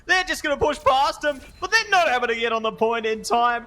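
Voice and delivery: high, loud, rising commentary call; excited play-by-play. Real, not acted. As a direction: shout the final push. Casting the final push.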